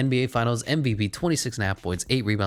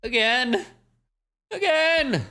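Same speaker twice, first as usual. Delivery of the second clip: exasperated; slower than usual. Real, not acted. Facing the Hawks again.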